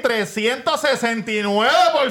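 slow, high, excited build; triumphant reveal. Real, not acted. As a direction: stretch out the big number for the crowd.

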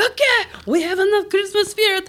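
high-pitched announcement over a crowd; high, mock-exasperated entertainer; hyped-up host. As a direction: call a rowdy crowd to order, playfully. Mock-halting the overexcited train crowd.

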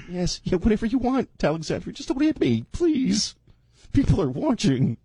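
high, whiny pleading voice; timid pleading man. Acted. Acting the fiancé.

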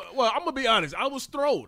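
loud, high-pitched astonishment; genuinely surprised. Real, not acted. Co-host thrown by his colleague's unexpectedly short answer, loudly surprised. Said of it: "I did not expect that from Arch".

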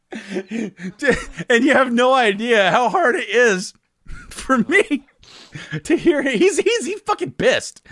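talking through laughter, slow; barely holding back laughter; laughing. Real, not acted. Friend recalls dad's reading, laughing. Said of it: "I'm trying not to laugh".

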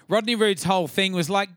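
ordinary delivery

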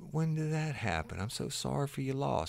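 a hammed-up, high voice of sympathy; mock sympathy. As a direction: pitch it up high, playing the sweetly sorry guy. Man offering sympathy in the story.

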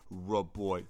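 ordinary talk

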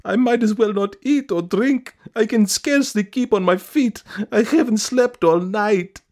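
very high, loud lament; hammed-up, self-pitying high wail. A narrator's reading. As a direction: wail out your woes in a piercing voice. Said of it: "wailed Madame Stuchkin".

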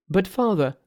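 high-pitched, pleading monks. Acted. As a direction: plead high as anxious followers to their protector. Priests object to Monkey.

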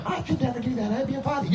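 high, soft imitated wife; mock-timid wife voice, high and quiet; prim refusing wife. Voices wife refusing to try joint.

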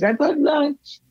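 [name]. Retold or imitated. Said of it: "[name] comes in ... and says".

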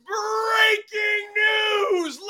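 high, loud, excited shouting. Real, not acted. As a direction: yell the big news with joy. Announcing blockbuster trade, shouting.

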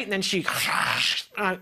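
ordinary talk

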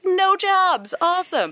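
high-pitched sarcastic cheer; mock enthusiasm. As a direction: cheer the bad news brightly, tongue in cheek. Ironic cheer at recession news.

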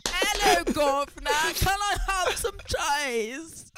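very high, shrieky British caricature; mock British accent. Caricature of British people wanting cheese, squealed mock British accent. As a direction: squeal like a hammed-up British beggar. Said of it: "what I think that British people are".

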